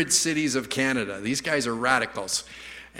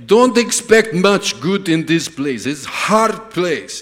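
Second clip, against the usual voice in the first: put-on slow, loud Russian accent; hammed-up Russian doom-saying; Russian driver; 7 dB louder, slower than usual. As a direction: lay on a heavy Russian accent, drawing it out. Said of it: "he goes on".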